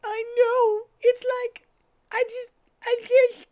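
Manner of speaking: very high, loud strained voice near tears; hammed-up choked Amy; holding back tears. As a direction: squeeze it out high, fighting a cry. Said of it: "trying to, not to cry".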